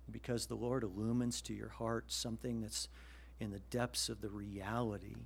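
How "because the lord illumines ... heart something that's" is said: ordinary delivery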